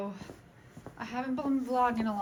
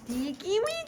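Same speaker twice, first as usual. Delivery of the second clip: talking to a dog; 10 semitones higher, 4 dB quieter than usual. Real, not acted. Cooing at the dog.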